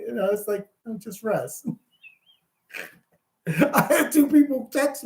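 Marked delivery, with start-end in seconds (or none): 0.9-1.7 much softer, settled answer; retold calm reply. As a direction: brush the worry away quietly. His calm answer to the fretting.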